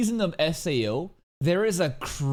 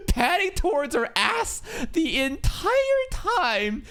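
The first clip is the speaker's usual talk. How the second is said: high, drawn-out disbelief; high-pitched incredulous rant; incredulous outburst; 11 semitones higher, slower than usual. Real, not acted. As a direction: stretch it out slowly, pitch way up. Ranting in mock disbelief.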